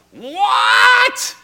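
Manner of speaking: sudden high furious yell of disbelief; angry shout. Retold or imitated. Angry host shouting at the man who deleted tape.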